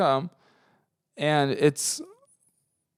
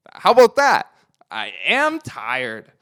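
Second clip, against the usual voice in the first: defiant; 7 dB louder, slower than usual. Real, not acted. Speaker challenging the guy who called them tired, loud and defiant. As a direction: throw it back at him.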